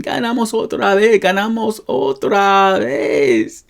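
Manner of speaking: high-pitched victory chant, last one stretched out; celebrating. Shown as a demonstration. Teacher acting out a team's repeated win, celebrating. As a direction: chant it in triumph, drawing out the end. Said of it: "celebrating a repeated victory".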